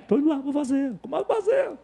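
high, softened voice; light, high-pitched re-enactment; acted eager offer. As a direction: act out going off obligingly, voice climbing high. Acting out eager popcorn offer.

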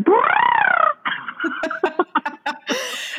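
high chirpy bird trill; budgie chirp. Retold or imitated. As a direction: chirp like a budgie during warm-ups. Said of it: "think about your budgie bird".

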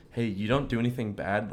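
normal talking voice

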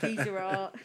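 laughing mock Cockney geezer voice. Hammed-up Cockney, laughing at being called Cockney. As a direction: ham up a Cockney accent. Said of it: "A little Cockney".

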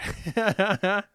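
laughing out loud; real amused laugh; burst of laughter. Real, not acted. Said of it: "I was laughing at you".

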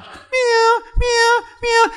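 long, high, pleading meows; imitated cat meow. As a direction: mimic a cat stuck in the garage all night. Imitating cat trapped all night.

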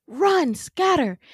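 loud, high, drawn-out warning; urgent call. Retold or imitated. Winter warning the pack to run, urgent shout. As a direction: call out the order to flee. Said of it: "turns to the pack and is like".

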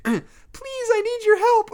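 put-on very high, small girlish plea; small feminine voice. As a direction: plead in a tiny girl's voice. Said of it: "a small feminine voice answered".

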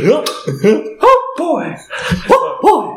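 high squeaky cartoon mouse; chirpy falsetto cartoon mouse; Mickey Mouse voice. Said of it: "or about Mickey".

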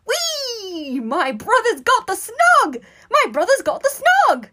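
very high, drawn-out gleeful squeals; squealing with delight. A narrator's reading. Brother dancing in a circle, squealing with delight. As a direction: chant it in a loud delighted shriek. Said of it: "squealing with delight".

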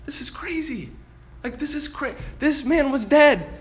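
high-pitched stunned disbelief, hushed at first; disbelieving thought. Retold or imitated. Disciples looking at the nail prints, stunned disbelief. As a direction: think aloud in amazement, landing harder on the last words. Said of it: "they're thinking in their head".

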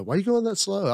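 wife asking. Retold or imitated. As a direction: pitch up into the wife's questioning voice. Wife questioning him in story.

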